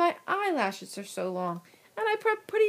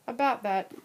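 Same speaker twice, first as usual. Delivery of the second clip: ordinary talk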